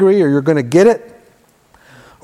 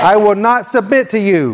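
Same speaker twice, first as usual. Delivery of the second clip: higher-pitched, emphatic faith declaration; like you mean it; 4 semitones higher than usual. Shown as a demonstration. Preacher leads congregation's repeat-after-me prayer, emphatic and meaning it. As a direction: proclaim it with conviction for others to echo. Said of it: "say it like you mean it".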